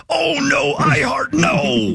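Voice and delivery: high, slow, strained gravelly wrestler yell; hammed-up Macho Man growl, drawn out; Macho Man Randy Savage. Host riffing a wrestler's reaction to a TV show, hammed-up Macho Man yell. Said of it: "my impression of Randy Macho Man Savage".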